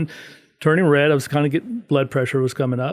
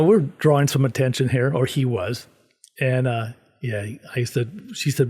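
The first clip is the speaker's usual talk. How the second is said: normal talking voice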